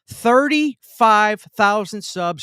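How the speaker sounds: slow, loud and proud, each word drawn out; stretched, celebratory reveal; proud announcement. Real, not acted. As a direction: announce the milestone like a victory. Celebrating subscriber milestone live.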